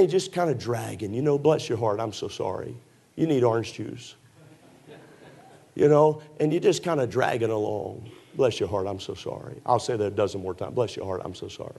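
low, soft, mock-sorry voice; sarcastic pity. Preacher teasing sleepy congregation, sarcastic pity. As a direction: pretend to feel sorry for them. Mocking tired churchgoers.

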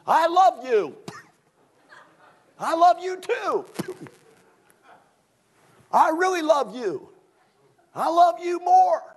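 high, loud, syrupy lovers trading lines; mock a sugary couple outdoing each other; mock lovey-dovey couple. Preacher mocking a lovesick couple, high and syrupy. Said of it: "If they're not yelling at each other".

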